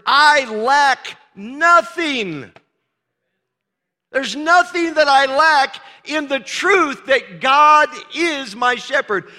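high, loud preaching cry; rising to a full-voiced preaching shout. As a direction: lift it to a shout of triumph.

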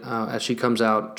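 normal talking voice

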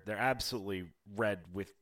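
ordinary talk, nothing marked

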